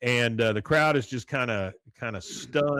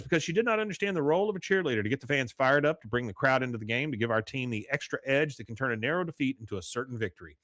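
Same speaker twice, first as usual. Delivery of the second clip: fast, rattled-off coach speech; rapid lecture; much faster than usual. Retold or imitated. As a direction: rush through it like a memorized speech. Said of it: "She goes".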